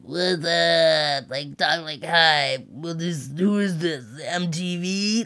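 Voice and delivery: imitating a dazed, groggy man; slow and out of it, barely there; spaced-out drowsy voice. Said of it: "Like, talking like, ... he was just out of it".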